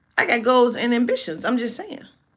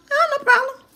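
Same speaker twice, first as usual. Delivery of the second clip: mock breezy; 4 dB louder than usual. Mocking easy compliance.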